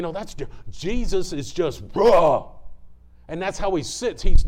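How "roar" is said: short, loud warrior roar; sudden loud mock roar; imitated roar. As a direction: roar it out like a battle cry. Imitates Jesus as a roaring warrior.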